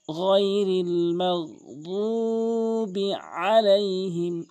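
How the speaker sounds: very slow melodic chant; Quran recitation. Acted. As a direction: chant it slowly. (ধীরে তেলাওয়াত)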